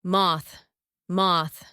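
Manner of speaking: "Moth" with short vowel, voiceless th. Shown as a demonstration. Teacher giving test answer, short vowel in "Moth". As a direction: keep "Moth" short, end on unvoiced th sound. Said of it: "Short vowel".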